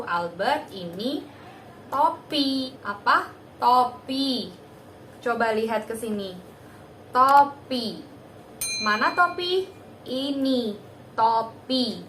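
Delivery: put-on a therapist naming a card to a toddler; clear teaching voice. Shown as a demonstration. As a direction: speak to a small child, slow and emphatic. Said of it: "harus diberi pemahaman terlebih dahulu".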